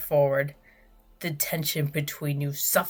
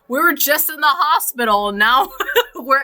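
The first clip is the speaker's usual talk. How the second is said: high, loud laughing whisper; laughing disbelief; 8 semitones higher, 9 dB louder than usual. Real, not acted.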